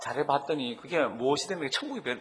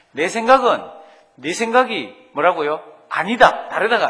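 much higher and louder, hammering the fix; emphatic correction; 4 semitones higher, 12 dB louder than usual. Shown as a demonstration. Pastor correcting the verse to his church, loud and emphatic. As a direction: correct the misquote with force. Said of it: "그게 아니라 그랬습니다".

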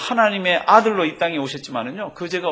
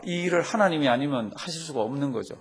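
usual speaking voice

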